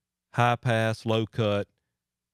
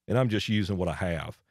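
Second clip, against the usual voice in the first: ordinary talk, nothing marked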